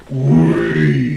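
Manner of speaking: very low, loud "Wee"; growled demo. Shown as a demonstration. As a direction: rev "Wee" like a deep engine. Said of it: "all you have to do is just go".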